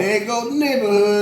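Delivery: high mock line with a grin; mock snooty neighbor. Friend repeats the joke, high and amused. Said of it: "you're like".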